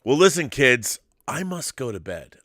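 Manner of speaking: usual speaking voice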